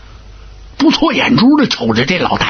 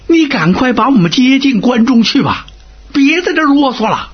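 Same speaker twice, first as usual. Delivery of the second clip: higher, testy demand; impatient order; 7 semitones higher than usual. Acted. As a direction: hurry him along, fed up. Officials order the garrison commander.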